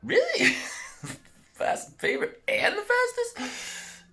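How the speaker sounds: high, slow, giggling incredulity; can't stop laughing while asking; high giggly stunned questions; laughing disbelief. Retold or imitated. Called favorite and fastest.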